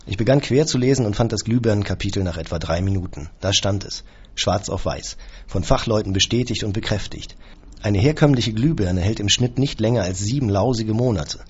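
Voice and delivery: plain reading voice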